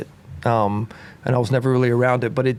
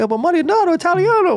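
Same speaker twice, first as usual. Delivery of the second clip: high mock Italian accent, insisting; imitating Italian locals; 4 dB louder than usual. Italian locals telling him he's Italian, in high mock Italian accent. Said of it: "They're like".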